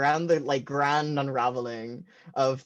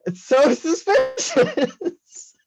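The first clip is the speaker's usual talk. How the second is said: high, slow, laughing suspicious; laughing mock suspicion; 7 dB louder, much slower than usual. Comedian laughing at family's secrecy, high drawn suspicious. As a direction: stretch it out through a laugh.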